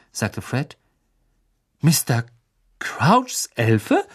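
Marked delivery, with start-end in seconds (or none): 1.8-4.0 louder, stunned disbelief; as if the news just hit him; thunderstruck. A narrator's reading. Brother hearing shocking news, thunderstruck. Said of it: "wie vom Donner gerührt".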